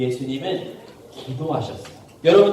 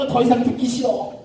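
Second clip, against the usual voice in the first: fed up; 4 semitones higher than usual. Acted. Judge sick of the widow's pestering, exasperated. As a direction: groan it out in mock annoyance. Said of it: "너무 귀찮고 짜증나고".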